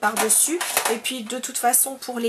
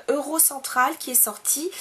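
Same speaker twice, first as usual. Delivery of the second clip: normal talking voice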